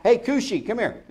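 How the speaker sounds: high-pitched mock summons; hammed-up nickname call with a laugh; joking call-over. Jokingly calls the king by nickname.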